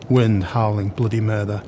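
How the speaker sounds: usual speaking voice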